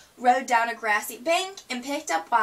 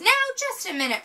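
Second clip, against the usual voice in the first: fast, higher indignant protest; 9 semitones higher, 3 dB louder, faster than usual. A narrator's reading. Sister interrupting Lizzie's lesson, a fast, higher protest. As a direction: cut in quickly to object. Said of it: "protested Sister".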